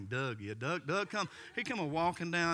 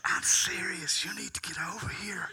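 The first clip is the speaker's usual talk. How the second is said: hard, loud stage whisper; insistent whisper; 3 semitones higher, 6 dB louder than usual. Retold or imitated. Caller begging the pastor to come, forceful whisper.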